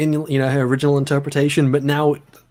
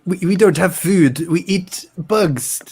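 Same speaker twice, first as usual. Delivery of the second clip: badly faked Russian accent, drawing the words out; 4 semitones higher than usual. Friend joking about starving communists, in a terrible Russian accent. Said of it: "That's, that's the shittest Russian accent I've ever done".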